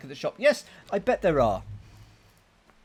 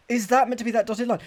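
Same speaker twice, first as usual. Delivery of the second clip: fast, raised, higher-pitched repeat; quick, louder question; 7 semitones higher, 6 dB louder, faster than usual. Real, not acted. Player re-asks about the map after coughing, quick and louder. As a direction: fire the question out fast and loud.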